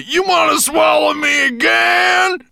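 high, loud and slow outraged question; alarmed dwarf. Acted. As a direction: shout it slowly in disbelief. Dwarf protesting.

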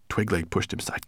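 ordinary delivery

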